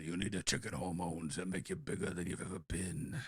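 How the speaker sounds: low, soft character voice; hushed, hammy movie-mobster pitch; persuasive tough-guy guru. Acted. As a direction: drop low and sell it confidentially. Continues the nugget-guru impression.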